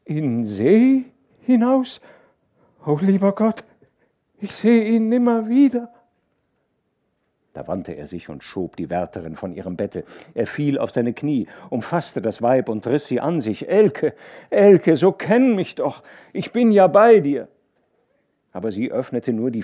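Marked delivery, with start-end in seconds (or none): 0.0-5.9 weeping. A narrator's reading. Feverish wife laments lost son, weeping wail. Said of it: "als ob sie weine". 13.7-17.5 high loud desperate pleading. A narrator's reading. Husband pleads with his delirious wife, loud.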